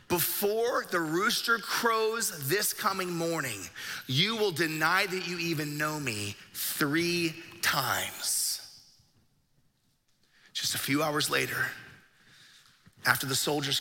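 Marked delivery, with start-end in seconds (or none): 0.1-8.6 slow, deliberate pronouncement; retold prophecy, measured and slow; solemn foretelling. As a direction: draw each word out with weight. Jesus solemnly foretells Peter's denial.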